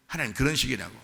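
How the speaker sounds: usual speaking voice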